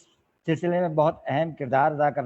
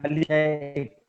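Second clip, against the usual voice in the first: measured ghazal recital with long pauses; poetry recitation. Acted. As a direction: recite the couplet phrase by phrase to an audience. (ग़ज़ल पढ़ने का ठहरा हुआ अंदाज़)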